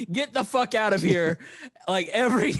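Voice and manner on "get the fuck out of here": imitated yell. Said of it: "You were, you were yelling".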